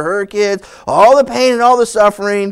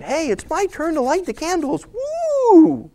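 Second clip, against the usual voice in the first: hammed-up cheer ending in a squeal; bored priest cheering his candle turn; mock-excited priest; 8 semitones higher than usual. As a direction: fake enthusiasm over lighting candles.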